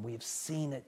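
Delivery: awed whispering testimony; hushed awe. Acted. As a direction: say it quietly, full of wonder. John quoted, hushed.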